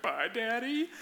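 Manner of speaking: put-on high, drawn-out little-girl voice; lightly mocked child's last farewell; daughter's forlorn goodbye. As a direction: rise plaintively like an anxious daughter. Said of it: "this was like a final goodbye for her".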